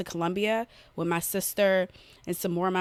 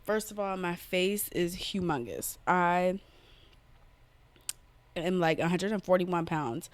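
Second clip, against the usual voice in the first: ordinary talk, nothing marked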